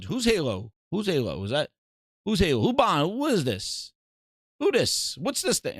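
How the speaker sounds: a hint of a confused executive; mock clueless boss. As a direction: play dumb like you've never heard of it. Mocking clueless Satya.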